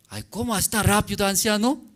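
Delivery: high, quick amazed cry; young man stunned that the old man fixed it so fast. Acted. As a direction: blurt it out in disbelief. Young man amazed engine started.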